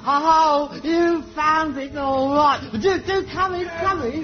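high-pitched theatrical hosting; gushing welcome. Acted. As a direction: usher guests in with effusive delight. Host gushes at arriving actors.

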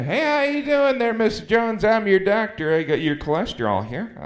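high, loud chipper doctor voice; cheery doctor. Retold or imitated. Doctor greeting patient Mister Jones, high and cheerful. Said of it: "at the doctor with this guy".

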